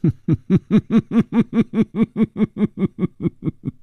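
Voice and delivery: long, mocking cackle; acted scornful laughter; loud cold laugh. A narrator's reading. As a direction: laugh coldly in a long staccato run. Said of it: "他大声地冷笑起来".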